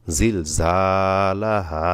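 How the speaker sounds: very slow জিল ঝালা হা with a long final আ; জিল ঝালা হা ending on a held open vowel; stretched stop. Shown as a demonstration. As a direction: chant জিল ঝালা হা and draw out the last হা. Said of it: "এখানে জবরের পরে খালি আলিফ আছে".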